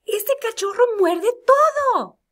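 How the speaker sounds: teacher reading an example with excitement; excited exclamation. Shown as a demonstration. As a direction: exclaim it like big news. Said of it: "Número dos dice".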